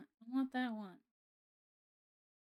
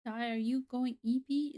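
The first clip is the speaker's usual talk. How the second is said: ordinary delivery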